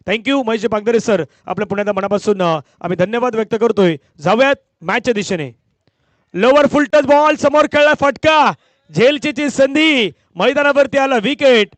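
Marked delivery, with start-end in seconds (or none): 6.3-11.7 loud, high, slow-stretched wicket call; live cricket play-by-play. Real, not acted. Commentator announces a wicket and hands to the DJ, excited play-by-play. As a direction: ring out each phrase high and drawn out.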